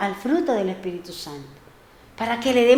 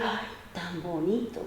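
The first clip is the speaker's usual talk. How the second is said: soft wonder; 6 semitones lower, 17 dB quieter than usual. Retold or imitated. Admiring the ironed trousers.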